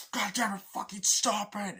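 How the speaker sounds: high, loud anguished shout; yelling don't do it; desperate yell. Retold or imitated. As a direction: scream at him to stop. Pleading yell in standoff.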